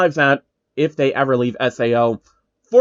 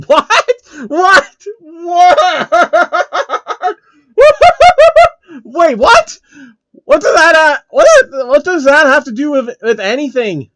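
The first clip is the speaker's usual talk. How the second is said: real shocked shouts broken by laughter, much higher; stunned disbelief; 9 dB louder than usual. Real, not acted. Shocked by a line in the story.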